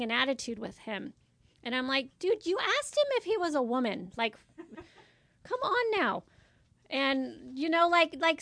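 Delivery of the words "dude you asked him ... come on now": high-pitched mock exasperation; exasperated disbelief. Office worker reacting to coworker's blunder, exasperated.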